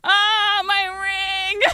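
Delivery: loud, high mock bawling; hammed-up wailing between gulping sobs; hyperventilating sobs. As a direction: re-enact sobbing over something precious. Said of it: "like, sobbing".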